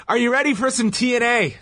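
high-pitched imitation of a show opener; mock crowd hype. Retelling Borden asking the audience, mock hype voice. Quotes wrestler's shout to the audience.

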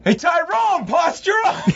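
very high relayed yell; mock shout. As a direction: shout it to the cage, hammed up.

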